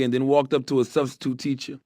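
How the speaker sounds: plain reading voice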